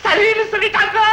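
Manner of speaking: high, excited cry of relief; jubilant shout. Acted. Groom announcing they have agreed, high excited shout. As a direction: burst out with the good news, pitch way up.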